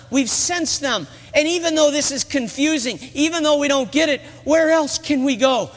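high, earnest pleading; impassioned plea. Acted. Peter answering Jesus on why they stay, impassioned. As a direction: plead with conviction, pitch held well up.

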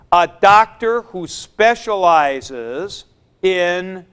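ordinary talk

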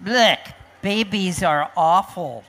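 mildly disgusted storybook crocodiles; lightly voiced grumpy crocodiles. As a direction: lead with a blech of disgust. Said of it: "the crocodiles told them".

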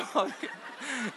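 high laugh running on; laughing at the memory; real laughter. Real, not acted. Laughing at his own story.

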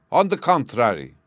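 king crying out loudly; cried out. Acted. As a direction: burst out in protest. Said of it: "cried the king".

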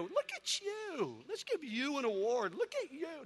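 high, slow, fawning mock admiration; mocking praise. Onlookers fawning over a showpiece, high mocking praise. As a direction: coo it like empty flattery. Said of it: "they won't go".

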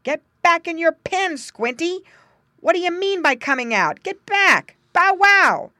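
high, loud barking scold; a farm dog ordering the pig home. A narrator's reading. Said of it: "that meant in his language".

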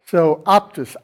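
normal talking voice

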